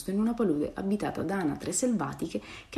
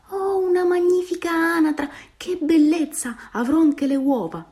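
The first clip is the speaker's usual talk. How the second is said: put-on very high, louder old-lady voice, drawn out; exclaiming old woman; 11 semitones higher, 5 dB louder than usual. A narrator's reading. Old woman mistaking the duckling for a fine duck, delighted exclamation. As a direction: coo high in delighted wonder. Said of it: "la vecchietta ... esclamò".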